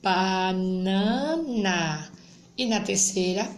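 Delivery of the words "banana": drawn-out syllables for beginning readers; teacher modelling a word for children; slow reading aloud. Shown as a demonstration. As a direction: stretch each syllable of banana slowly. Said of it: "Na segunda".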